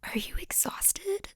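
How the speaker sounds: God whispering a kind question; gentle whisper. Retold or imitated. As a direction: ask it like a tender whisper from God. God's gentle question, quoted.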